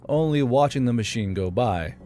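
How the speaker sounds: usual speaking voice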